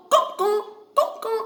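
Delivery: high crow caw sounds; crow caw demo. Shown as a demonstration. Teacher demonstrating a crow's sound to students, high caws. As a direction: show the class how a crow caws. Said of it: "You know what is the sound of crow?".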